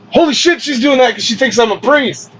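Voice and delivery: high-pitched burst of mock astonishment; retold shocked realization. Realizing cookie girl believes him a priest, astonished. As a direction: blurt it high as it dawns on you.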